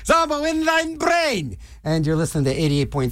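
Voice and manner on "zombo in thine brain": high, slow, otherworldly announcer voice; spooky DJ sign-on. Acted. DJ opening his show, in a high slow eerie voice. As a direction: drawl the station ID like a ghostly emcee. Radio station signature sign-on.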